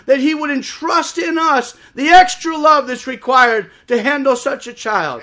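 high, loud preacher's declaration; impassioned defiance. Retold or imitated. Father vowing to doctors he will love his child, impassioned shouting. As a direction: thunder it out as an unshakeable vow.

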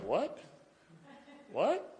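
rising puzzled what; mock confusion. Someone who wronged him, baffled at his apology, rising sharply. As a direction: play baffled, pitch climbing. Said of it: "They may be like".

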